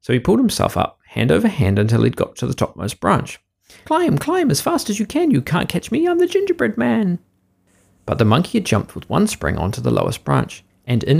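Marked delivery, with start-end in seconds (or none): 3.8-7.2 high, sing-song taunting little voice; gingerbread man voice. A narrator's reading. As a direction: chant it high like a cheeky cookie. Gingerbread man's taunting rhyme.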